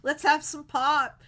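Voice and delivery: loud mock Pittsburgh. Hosting Pittsburgh viewers, a loud hammed-up Pittsburgh call. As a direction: belt it like a proud Pittsburgh local. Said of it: "That's a Pittsburgh thing".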